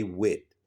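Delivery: "wit" with a hard t, no th; Philly wit. Shown as a demonstration. Philly locals saying with as "wit". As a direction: clip "wit" short, end on a t. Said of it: "they say".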